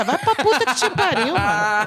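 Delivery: loud curse through laughter; high, laughing mock insult. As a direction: swear at him while laughing. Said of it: "Eu falei".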